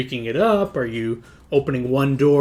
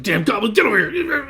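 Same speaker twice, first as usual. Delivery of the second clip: goblin shout; 10 semitones higher, 4 dB louder, faster than usual. Retold or imitated. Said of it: "You just hear, like".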